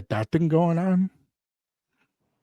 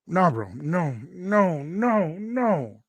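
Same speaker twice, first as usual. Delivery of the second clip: indignant; 6 semitones higher than usual. Retold or imitated. Indignant protest.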